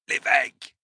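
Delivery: high, strained outcry; pained cry. A narrator's reading. Wounded attacker under torture, crying out. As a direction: cry out trying to escape the pain. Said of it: "s'écria-t-il en essayant d'échapper".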